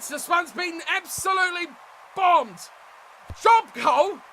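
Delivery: ordinary talk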